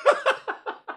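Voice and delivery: high-pitched laugh bursting out; burst of laughter. Real, not acted. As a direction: crack up laughing. Laughs at friend's call.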